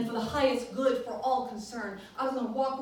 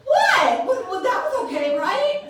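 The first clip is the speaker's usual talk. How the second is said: high, loud, startled what; shocked protest; 12 semitones higher, 11 dB louder than usual. Retold or imitated. Preacher playing herself shocked at her inner voice. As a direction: squeal it in disbelief.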